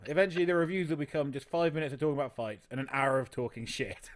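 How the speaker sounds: ordinary talk, nothing marked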